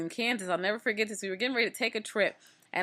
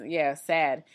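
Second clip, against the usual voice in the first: ordinary talk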